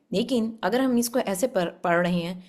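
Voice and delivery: ordinary talk, nothing marked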